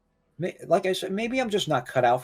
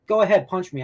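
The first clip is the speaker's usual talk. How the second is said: higher, louder dare; defiant challenge; 7 semitones higher, 5 dB louder than usual. Real, not acted. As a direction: throw the dare back in her face. Defiant reply to mother's threat.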